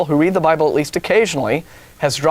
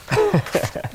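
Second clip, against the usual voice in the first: real chuckle. Real, not acted. Laughing at his own joke.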